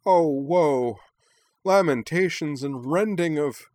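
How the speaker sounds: loud, high, hammed-up wailing; dramatic lament. A narrator's reading. Minami mock-mourns the news of the marriage, dramatic lament. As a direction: declaim the woe like a tragedienne. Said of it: "cried dramatically".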